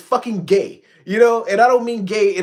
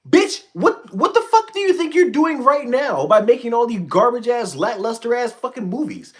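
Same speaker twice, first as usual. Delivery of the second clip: angry outburst; 6 semitones higher than usual. Real, not acted. Angry outburst at studio movies.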